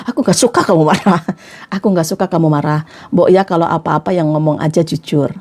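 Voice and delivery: low, calm plea to a partner; calm honesty. Shown as a demonstration. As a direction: gently own the complaint, voice well down. Models how to tell partner. (menegur tenang dengan suara rendah)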